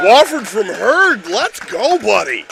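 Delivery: high, loud, drawn-out cheer; elated shout. Real, not acted. Co-commentator celebrating Wofford's goal from Hurd, elated. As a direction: still buzzing, hail the scorer.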